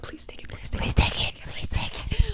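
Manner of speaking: unvoiced repeated whispered plea; whispered pleading. Watching the film, begging the character in a whisper. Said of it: "I was like".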